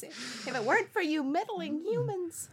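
high, theatrical cartoon villain whine; Scooby-Doo villain voice. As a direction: sneer it like an unmasked villain. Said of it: "You Scooby-Doo villain voice".

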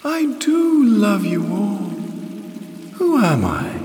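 high, sustained flute-like goddess; mellifluous, fluting voice. A narrator's reading. Water goddess addresses her kneeling tribe, high fluting voice. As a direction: let the words float high and fluting. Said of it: "in a mellifluous, fluting voice".

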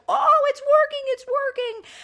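very high, loud excited squeal; surprised. Retold or imitated. Brother sees his volcano suddenly erupt, high mock amazement. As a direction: mimic an amazed kid whose experiment finally works. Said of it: "he looks so surprised".

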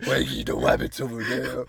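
low, loud put-on cartoon voice; deep cartoon voice. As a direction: drop it low like a cartoon hunter. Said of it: "You've got a deep ass voice now".

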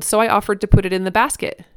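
ordinary delivery, nothing marked

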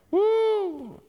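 high, drawn-out "woo"; excited whoop. Real, not acted. Preacher thrilled by God's promise, high excited whoop. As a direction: let out a high whoop of delight.

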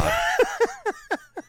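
sudden high-pitched laugh; high burst of laughter. Real, not acted. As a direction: crack up, laugh high and hard. Host laughs at the story.

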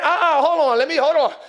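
loud mock objection; objecting self voice. Believer interrupting God's voice, loud mock objection. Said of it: "Your voice is saying".

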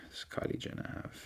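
whispered to himself; murmured search query. Real, not acted. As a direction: breathe it while typing.

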